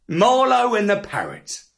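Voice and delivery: plain reading voice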